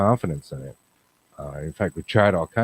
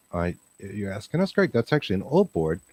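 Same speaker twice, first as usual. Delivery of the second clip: ordinary talk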